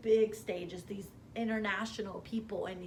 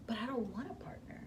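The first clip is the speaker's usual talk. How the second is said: fast, whispered sudden panic; whispered realization; 2 semitones higher, 6 dB quieter, faster than usual. Retold or imitated. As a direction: hiss it out as it hits you. Sudden realization after call.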